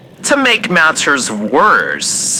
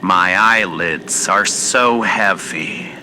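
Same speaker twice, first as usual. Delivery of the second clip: drowsy, fading murmur; drifting off to sleep mid-sentence; nodding off; 4 semitones lower, 6 dB quieter than usual. Acted. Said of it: "I do believe I'm falling asleep".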